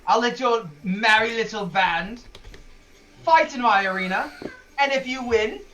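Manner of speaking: incredulous, blustering boss, then bargaining; Fritz, affronted arena boss. Acted. Arena boss offering intruders a fight, blustering. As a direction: scoff at the cheek, then lay out terms.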